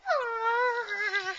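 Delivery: long, high falling "aw"; disappointed whine. Pretend. Child told no by mom in pretend play, whiny groan.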